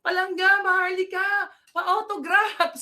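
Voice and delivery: high, loud, gushing fan, autograph drawn out; excited fan. Retold or imitated. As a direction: squeal like a starstruck follower. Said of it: "merong follower na nagsabi".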